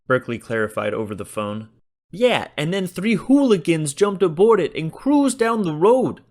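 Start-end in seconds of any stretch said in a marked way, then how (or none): 2.1-6.2 much higher, louder, agitated shopkeeper voice; irate shopkeeper. A narrator's reading. Shopkeeper on the phone, irate about the robbery. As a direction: complain like an outraged store owner. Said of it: "an irate shopkeeper explained".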